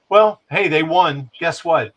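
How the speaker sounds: loud, high sarcastic jab. Real, not acted. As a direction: throw out a mocking gotcha. Sarcastic outburst about strike.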